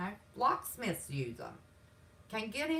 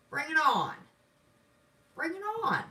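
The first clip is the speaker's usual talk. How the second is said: defiant challenge; 7 semitones higher, 6 dB louder than usual. Real, not acted.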